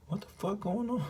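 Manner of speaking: high, stunned question. Retold or imitated. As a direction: gasp it out in disbelief. Shared shock with his woman.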